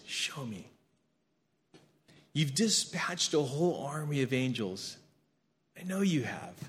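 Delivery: soft, hesitant plea to God; a gentle, breathy-free murmured petition; humble prayer. Pretend. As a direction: ask quietly, like a small private prayer.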